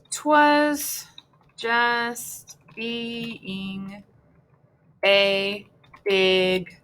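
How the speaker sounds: each word held, with long gaps; drawn-out composing. Real, not acted. Writing the baby line, slow and drawn out. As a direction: spell it out slowly by ear as you write.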